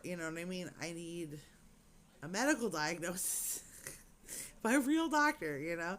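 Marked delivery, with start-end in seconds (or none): 4.6-5.6 "real doctor" pushed out louder and higher; emphatic frustration. Real, not acted. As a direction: hit "real doctor" hard in exasperation. Frustrated about no diagnosis.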